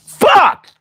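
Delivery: sharp high shout of frustration; frustrated shout. Real, not acted. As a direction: yell it in frustration. Frustrated shout after cut.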